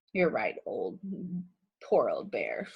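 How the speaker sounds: low, soft sighing mock pity; a lightly sighed mock sympathy. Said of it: "sighed Hare".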